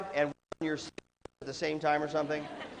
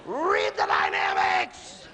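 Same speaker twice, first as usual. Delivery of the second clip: much higher, louder, slow command; shouted command; 13 semitones higher, 5 dB louder, much slower than usual. Real, not acted. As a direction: bark the order at the choir. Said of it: "director's command".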